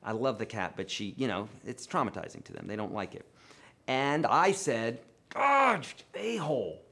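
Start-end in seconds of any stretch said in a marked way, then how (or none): 5.3-6.8 sudden high yelp of pain, then a-hole falls; startled yelp of pain, God jumps high; pained outburst. Retold or imitated. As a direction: cry out God as the cat scratches. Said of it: "because of the way that I said it".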